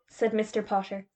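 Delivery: usual speaking voice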